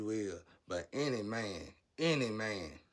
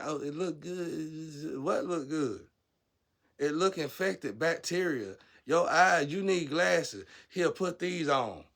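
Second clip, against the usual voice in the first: mocking rant; 4 semitones higher, 5 dB louder than usual. Real, not acted. Mocking rant about looks.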